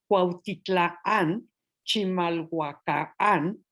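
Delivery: slow "Cuautitlán", "Chimalhuacán", stressed on the last syllable; drawn-out "Cuautitlán, Chimalhuacán" with the stress landing on "tlán" and "cán"; "Cuautitlán" and "Chimalhuacán" spoken slowly, stress at the end; final stress. Shown as a demonstration. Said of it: "que hoy decimos con acento en la sílaba final".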